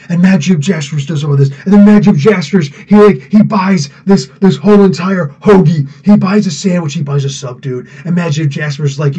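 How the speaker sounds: gushing, obsessive comic-fan impression; excitable fanboy host, punching the favorite name; Rob. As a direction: imitate a YouTuber raving about a favorite character. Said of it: "Let's really do Rob".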